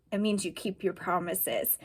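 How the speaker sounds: usual speaking voice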